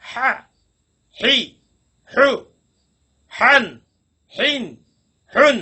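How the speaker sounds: each হা sound breathed from the middle of the throat, spaced out; throaty ha drill. Shown as a demonstration. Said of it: "হাই উচ্চারণগুলো করবো". (গলার মধ্যখান থেকে হা-এর উচ্চারণ)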